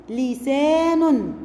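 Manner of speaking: لسان drawn out slowly; slow syllable reading. Shown as a demonstration. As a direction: read لسان slowly for children. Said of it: "تعالوا نشوف ده في كلمات".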